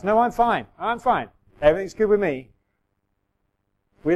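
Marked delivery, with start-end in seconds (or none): 0.0-2.5 higher, louder brave-face denial; hammed-up chirpy "I'm fine"; insisting you're fine. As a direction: loudly wave off the worry. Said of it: "nearly got run over by a bus".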